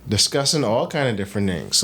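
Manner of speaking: ordinary talk, nothing marked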